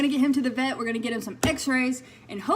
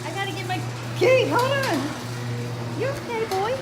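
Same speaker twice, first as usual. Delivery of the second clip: much higher, louder sing-song pet voice; 9 semitones higher, 5 dB louder, slower than usual. Real, not acted. Cooing at the dog by the car.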